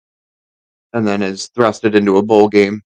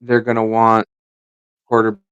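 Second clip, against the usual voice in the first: long gaps between fragments; disjointed, stop-start speech; halting, breaking up. Real, not acted. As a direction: stall mid-sentence, words dropping out. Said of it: "Sounds like he's actively having a stroke".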